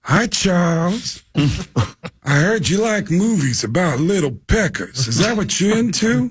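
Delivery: high, slow, drawn-out movie-star impression; hammed-up screen legend on a prank call; Al Pacino. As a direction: stretch the words in a put-on, swooping voice. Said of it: "I'm talking to Al Pacino".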